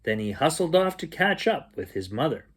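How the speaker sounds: ordinary delivery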